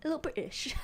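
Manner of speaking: soft, playful British accent on "A little British". Shown as a demonstration. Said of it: "you know, mate?".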